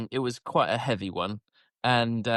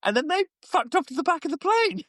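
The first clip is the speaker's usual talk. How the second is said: squeaked out very high; incredulous squeal; high-pitched disbelief. Real, not acted. Storyteller on the parents abandoning their children, high squeaky disbelief. Astonished telling of parents leaving.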